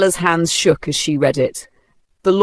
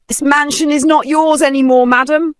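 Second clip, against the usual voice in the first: stern official; 11 semitones higher, 5 dB louder than usual. Acted. Bank manager evicting widow.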